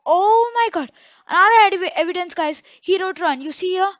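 frantic escape, yelling to run; being chased. Real, not acted. Ghost wrote run, fleeing.

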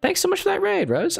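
very high, gushing gratitude; excited thanks. Real, not acted. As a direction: squeal out the thank you. Thanking a raider on stream.

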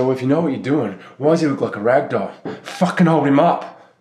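furious shouting, loud and high; angry outburst. Acted. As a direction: yell it at them, outraged. Retold angry outburst at nurses.

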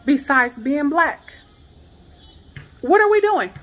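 rising, strained and pleading, repeating the question; distressed pleading. Real, not acted. Woman in her garage asks what they did wrong, distressed. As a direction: keep asking why, voice climbing toward tears. Upset rant about police call.

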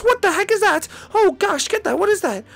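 usual speaking voice

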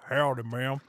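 teasing imitation of a cowboy accent. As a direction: put on a low cowboy greeting. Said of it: "You're like".

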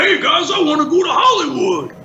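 big lovable monster voice, pitched high; a hulking monster shouting to his pals; Sweetums. Acted. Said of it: "my version of Sweetums".

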